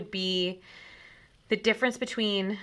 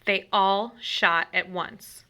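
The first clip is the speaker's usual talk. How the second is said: usual speaking voice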